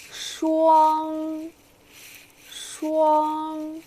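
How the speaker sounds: 霜 held high and level; 霜 flat and high; 霜 with a steady high pitch; high level first tone. Shown as a demonstration. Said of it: "the first tone is high and straight".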